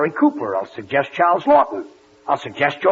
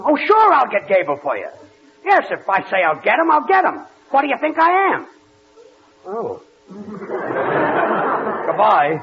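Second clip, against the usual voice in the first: indignant bluster, pitch leaping on key words; blustering assurance; 3 semitones higher than usual. Acted. Man on phone promising the sponsor Gable, blustering. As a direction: promise with overdone confidence.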